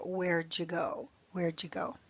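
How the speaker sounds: "Where'd you" blended into wherejoo; linked reduced form. Shown as a demonstration. Teacher to students, relaxed native wherejoo. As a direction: run "Where'd you" together casually.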